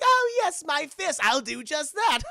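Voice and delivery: high, loud hammed-up villain; gleeful villain voice. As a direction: crow it like a cartoon baddie. Streamer voices villain Doctor Psycho.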